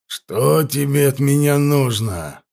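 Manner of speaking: slow, held-back anger; through gritted teeth. A narrator's reading. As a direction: hold the rage in. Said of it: "сквозь зубы спросил Кероний".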